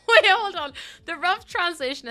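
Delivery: high, loud urgent stop; sudden loud call. Real, not acted. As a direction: cut in loudly. Sudden interruption, much louder.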